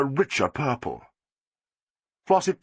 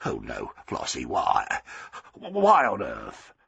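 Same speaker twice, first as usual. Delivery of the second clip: Arthur, dismayed; 11 semitones lower, slower than usual. A narrator's reading. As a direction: protest weakly, appalled.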